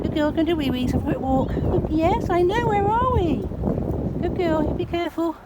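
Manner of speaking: high, loud praising dog talk. Real, not acted. Owner praising her dog in the park, high loud praise.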